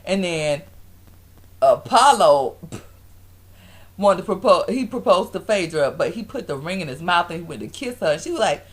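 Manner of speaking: ordinary talk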